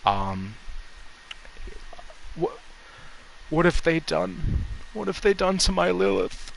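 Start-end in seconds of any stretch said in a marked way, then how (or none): 2.4-6.5 put-on high, soft, grief-stricken old man's mutter; muttering to himself. Dying father reads his child's name on the shoe, muttering in grief. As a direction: murmur it to yourself in a raised voice, close to sobbing. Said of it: "he just starts muttering to himself".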